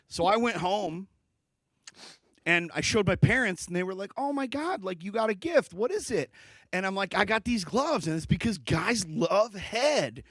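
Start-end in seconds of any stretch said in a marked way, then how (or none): 4.2-6.3 high-pitched excited parent reaction; imitating delighted, curious parents. Parents greet his new gift. 7.1-10.2 put-on proud kid announcing it, pitched up; raised, assured kid delivery; super confident. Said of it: "said it super confidently to my parents".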